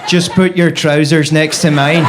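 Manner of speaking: loud, menacing Belfast impression; Belfast accent. Terrifying Belfast lover in bed, loud put-on Belfast accent. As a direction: order it in a hard Belfast accent. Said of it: "someone with a Belfast accent".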